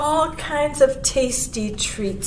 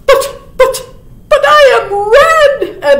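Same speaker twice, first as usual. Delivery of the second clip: high, loud, sputtered comeback; stammer, then proclaim it high and loud; announcing cardinal; 14 semitones higher, 10 dB louder than usual. A narrator's reading. Cardinal with nothing else to boast, high loud announcement. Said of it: "announced the cardinal".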